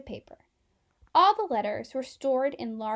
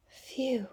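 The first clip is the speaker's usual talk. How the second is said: soft breathy sigh of relief; whispered phew; 5 dB quieter than usual. A narrator's reading. Letter relieved by the medics, whispered phew.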